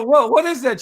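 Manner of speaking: high, alarmed retold question; suddenly worried what it was; mock alarm at the snack; startled question. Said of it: "I go".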